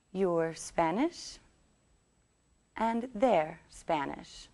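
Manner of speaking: slow, clearly separated words for learners; slow repeat-after-me model. Shown as a demonstration. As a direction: model each short sentence slowly, pausing between them. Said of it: "repeat these sentences after me".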